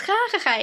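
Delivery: high mock "ha ha ha"; high-pitched pretend giggle; imitated child's laugh. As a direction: imitate a little one cheering up with a laugh. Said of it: "Y ya se ríe".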